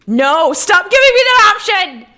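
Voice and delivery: very high, very loud shout; exasperated yell. Real, not acted. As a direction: yell in high-pitched protest. Exasperated by repeated game option.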